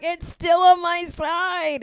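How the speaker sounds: loud, high, drawn-out mock yell; retold exasperated outburst. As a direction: wail the complaint, stretching each word. Retold meltdown over onion on board.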